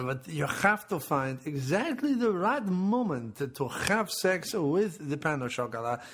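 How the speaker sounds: wobbly, hammed-up French accent. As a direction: warn them in dubious mock French. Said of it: "It does sound French".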